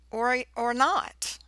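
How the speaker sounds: usual speaking voice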